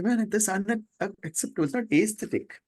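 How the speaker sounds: a hushed, pinched mock complaint; mock indignation. Imagined disciple rejecting ugliness.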